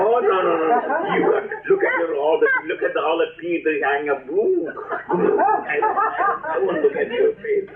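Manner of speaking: high, laughing squeals of a scared sheep; frightened sheep. Acted. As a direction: shrink back, giggling with fright. Sheep refuses to look.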